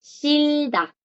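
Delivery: Shilda held long and high, then dropping on da; silent J. Shown as a demonstration. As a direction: model Shilda slowly, R sounding as L and no h. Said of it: "no suena".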